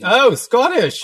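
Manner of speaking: high, loud mock Scottish exclamation; hammed-up Scottish burst; Scottish accent. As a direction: cry out slowly in a put-on Scottish accent. Calls out nationality in put-on voice.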